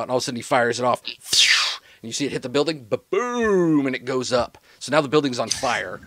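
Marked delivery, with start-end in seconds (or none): 2.9-3.9 raised, drawn-out boom falling in pitch; quick "ba" into a long high "boom"; explosion ba-boom. Pretend. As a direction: imitate a rocket blast mid-story. Imitates rocket hitting the building.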